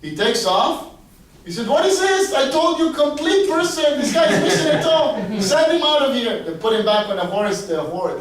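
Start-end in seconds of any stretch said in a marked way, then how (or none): 1.7-6.4 high, loud indignant rant; acted-out exasperated outburst; outraged sorcerer. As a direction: throw him out in a fury. Sorcerer rejects the incomplete victim.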